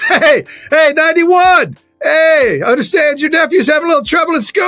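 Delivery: high, carrying heckler's shout; mock heckling. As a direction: call across the stadium to a player. Acts out heckler calling to player.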